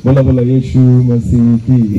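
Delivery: low, slow, drawn-out rallying call; call-and-response cry. Real, not acted. Preacher cues the congregation to answer, drawn-out rallying call. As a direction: stretch each word to cue the crowd.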